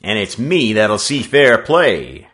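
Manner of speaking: loud, slow, weighty interjection; heavy, emphatic announcement; man's heavy voice. A narrator's reading. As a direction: weigh in as a burly workman. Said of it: "said a man's heavy voice".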